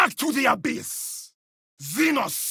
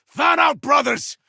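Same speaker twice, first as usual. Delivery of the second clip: loud, raised-pitch order; shouted battle command; 4 semitones higher, 5 dB louder than usual. Acted. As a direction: call out to the squad.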